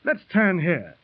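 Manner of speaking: usual speaking voice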